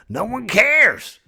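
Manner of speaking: high, drawn-out angry wail; hammed-up raging outburst; exasperated wrath. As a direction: cry it out to a world that ignores you. Mother Earth in an acted rage.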